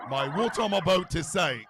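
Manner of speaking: clumsy put-on Cockney; badly faked Cockney accent in full voice; failed Cockney attempt. Shown as a demonstration. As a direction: have a go at Cockney and miss. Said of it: "if I tried the Cockney accent".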